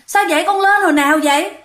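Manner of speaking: high, frightened calling to parents; scared call. Retold or imitated. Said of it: "trời, nó sợ".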